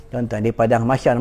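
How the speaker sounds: usual speaking voice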